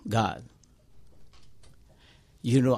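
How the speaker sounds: ordinary talk, nothing marked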